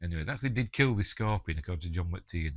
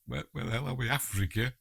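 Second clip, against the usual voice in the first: normal talking voice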